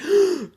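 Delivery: loud, sharp mock gasp; shocked gasp. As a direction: suck in air in hammed-up shock. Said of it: "And Kakashi's like".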